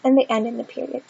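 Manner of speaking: normal talking voice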